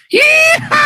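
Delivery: very high whooping "yee-haw"; cowboy yeehaw. Pretend. Joking about horse play, high cowboy whoop. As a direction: let out a shrieked, playful rider's whoop.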